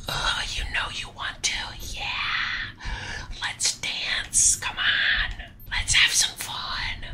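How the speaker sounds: slow teasing whisper; whispering while hiding. Real, not acted. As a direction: coax them in a hushed voice.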